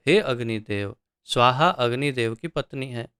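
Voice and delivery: ordinary delivery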